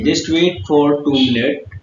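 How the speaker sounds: ordinary talk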